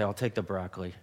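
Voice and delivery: low, subdued, grudging concession; reluctant. Acted. Diner giving in and ordering the healthy side, reluctantly. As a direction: say it low and soft, resigned to the choice. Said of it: "say it in that kinda tone of voice".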